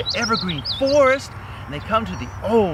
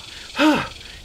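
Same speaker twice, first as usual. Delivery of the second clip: big breathy exhale; relieved sigh; 4 semitones higher than usual. Acted. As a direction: let out a long breath after stopping. Mouse comes to a stop.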